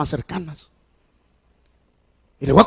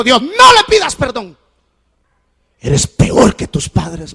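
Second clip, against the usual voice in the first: daughter's rebuke; 3 semitones higher, 7 dB louder, slower than usual. Retold or imitated. Daughter rebukes him, quoted.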